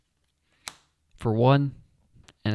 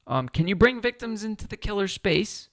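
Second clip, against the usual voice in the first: put-on slipping into a higher British accent mid-question; 8 semitones higher than usual. Wondering about the rules in a British accent. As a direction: ask it in a sudden British accent. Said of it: "I don't know why I'm turning British".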